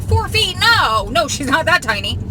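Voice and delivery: high, loud protest of disbelief; shocked disbelief. Retold or imitated. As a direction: exclaim it in alarm, pitched right up. Reacting to own editing slip.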